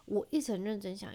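ordinary talk

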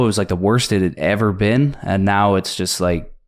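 normal talking voice

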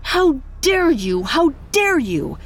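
high, screeching fury; shaking with anger. Acted. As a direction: screech it out, trembling with rage. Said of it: "Diwata screeched her sister's name".

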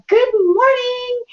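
high, slow sing-song good morning; mock cheery mom. Son imitating mom's wake-up call, sing-song. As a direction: chirp it way up like an overeager mom. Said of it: "you'd be like".

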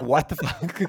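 high, loud, laughing incredulity; mock disbelief. Podcaster voicing a viewer jarred by the cut to credits, laughing disbelief. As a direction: act baffled through laughter. Acting out viewer's reaction, amused.

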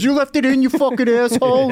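high, mock-furious outburst; imitating angry Lev. Retelling how Lev yelled about the gum, high mock fury. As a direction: squeal it like he's outraged at you. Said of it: "He goes".

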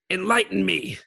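a hint of a screaming villain; lightly voiced villain yell; unhinged villain. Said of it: "That was a terrible impression".

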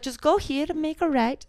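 high-pitched, badly faked French accent; weird French accent. Friend retelling a French woman's directions, in a bad French accent. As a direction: put on a wonky French accent. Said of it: "That was my weird French accent".

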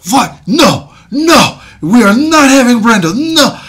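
loud, high outraged no; hammed-up executive outrage; mock indignant refusal. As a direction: refuse like an angry exec. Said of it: "Bludhorn is like".

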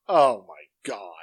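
slow, low mock exasperation; retold exasperation. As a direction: drag it out in weary disbelief. Retelling own exasperated reaction.

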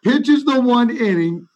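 can barely get the words out for laughing; real laughter breaking into the story; laughing through words. Real, not acted. Laughing while telling funny story.

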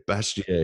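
Bastiat said the French way, bahs-TYAH, falling; French pronunciation. Shown as a demonstration. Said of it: "how do you say that in French?".

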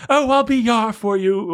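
high, sugary mock voice of Tracy Lord; hammed-up swooning heroine. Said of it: "When she says that at the end".

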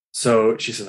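ordinary talk, nothing marked

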